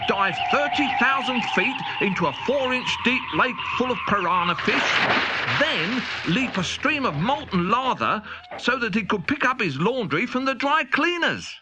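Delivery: plain reading voice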